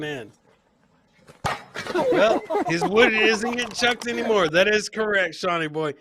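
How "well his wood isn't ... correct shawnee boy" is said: ordinary delivery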